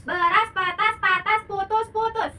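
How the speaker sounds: loud, high, crisp syllables; articulation drill. Shown as a demonstration. Teacher leading a tongue-twister warm-up, loud high chant. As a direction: spell out every sound to the class. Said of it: "Nah, good".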